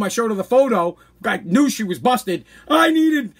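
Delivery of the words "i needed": high, loud mock whine; whining. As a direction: imitate a guilty mother's wail. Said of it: "Whining like".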